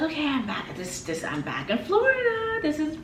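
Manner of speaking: high, bubbly relief at being back; excited homecoming. Retold or imitated. To herself on arriving in Florida, excited high voice. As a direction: squeal it like you're finally home. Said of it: "So I was like".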